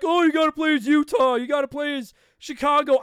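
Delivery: lightly mocking know-it-all fan. Host mocks fans who tell him which team to pick, mildly mocking.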